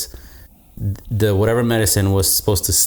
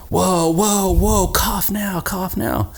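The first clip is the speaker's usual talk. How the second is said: urgent doctor's command, raised high; doctor yelling; 10 semitones higher, slower than usual. Retold or imitated. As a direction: bark the order like a panicked doctor. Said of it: "yelling at a top of a lung".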